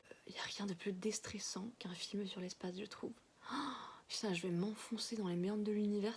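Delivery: barely whispered through a sore mouth; after wisdom teeth. Real, not acted. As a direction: speak soft and careful, jaw hurting. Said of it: "J'arrive toujours pas bien à parler".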